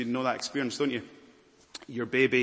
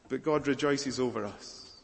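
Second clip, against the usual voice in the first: ordinary talk, nothing marked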